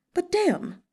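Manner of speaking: high, exasperated "But damn!"; a sudden frustrated cry from Fu Man; exasperated outburst. Acted. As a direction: throw up your hands in frustration.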